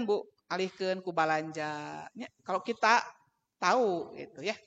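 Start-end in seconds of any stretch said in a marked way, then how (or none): none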